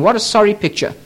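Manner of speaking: usual speaking voice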